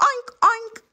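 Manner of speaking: high-pitched spoken oinks; pig's oink. A narrator's reading. The pig answers Kitty, oinking high. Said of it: "the pig says".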